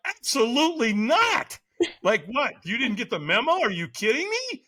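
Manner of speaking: high-pitched mock disbelief; indignant refusal. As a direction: refuse as if the very idea is crazy.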